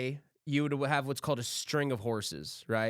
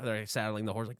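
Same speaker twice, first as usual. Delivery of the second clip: ordinary talk, nothing marked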